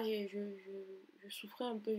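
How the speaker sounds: ordinary talk, nothing marked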